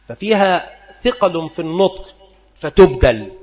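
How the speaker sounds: ordinary talk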